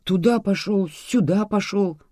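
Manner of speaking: lightly voiced tired man grumbling; helpless, put-upon passenger; weary man's complaint. A narrator's reading. Weary man shrugs off questions.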